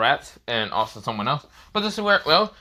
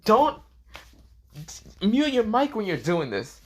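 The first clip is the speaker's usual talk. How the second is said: put-on scolding girl; 11 semitones higher, slower than usual. A narrator's reading. Junko scolds him in acted dialogue.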